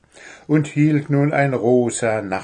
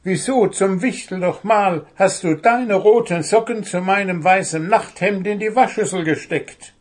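high, loud exasperated gnome; indignant raised-voice interrogation; barely contained anger; 10 semitones higher, 6 dB louder than usual. A narrator's reading. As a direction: scold with temper close to bursting. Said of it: "Er hatte Mühe, an sich zu halten".

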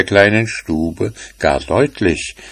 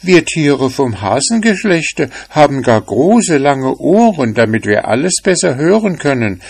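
higher small rabbit voice, eager to explain; lightly voiced bunny, earnest; timid rabbit; 7 semitones higher than usual. A narrator's reading. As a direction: tell about the long ears, a little nervous.

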